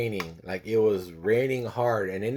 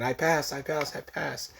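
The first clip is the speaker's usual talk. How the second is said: higher-pitched, acted joyful announcement; retold excitement; 6 semitones higher, slower than usual. Son running into dad's arms, acted excitement. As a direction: blurt it out like an overjoyed teen. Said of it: "I was so excited".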